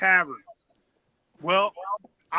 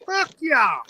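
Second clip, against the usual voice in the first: higher, louder, defiant shrug of a reply; mock defiant retort; 10 semitones higher, 7 dB louder, much slower than usual. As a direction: toss it back like a toothless old guy who doesn't care. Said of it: "and he'd be like".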